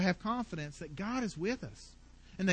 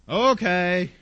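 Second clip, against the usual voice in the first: a mock grudging, eye-rolling okay, louder; sullen teen okay; 3 semitones lower, 5 dB louder than usual. Daughter rolling her eyes at her parent, sulky. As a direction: play the daughter giving in with a sulky sigh. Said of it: "You know, the eye roll and".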